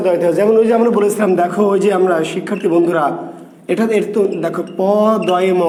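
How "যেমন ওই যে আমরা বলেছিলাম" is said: ordinary talk, nothing marked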